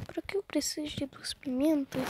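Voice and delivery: fast unvoiced whisper to himself. Real, not acted. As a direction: whisper the question quickly under your breath. Asks himself while playing. (sussurro rápido)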